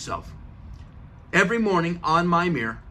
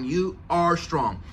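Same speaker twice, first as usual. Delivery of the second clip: ordinary delivery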